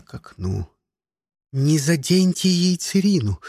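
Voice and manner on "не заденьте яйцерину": ordinary delivery, nothing marked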